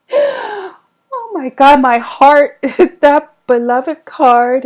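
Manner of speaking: gush, close to tears, with a laugh; overcome. Real, not acted. Tarot reader overcome by the beloved card, tearful. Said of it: "I wanna cry".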